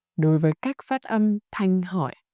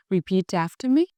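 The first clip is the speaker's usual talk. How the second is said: ordinary talk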